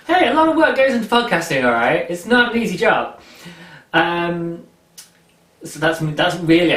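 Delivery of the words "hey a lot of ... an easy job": high, loud, fast protest; playfully indignant outburst; mock defensive. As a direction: defend yourself against your own inner critic. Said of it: "I'm talking to my internal demons there".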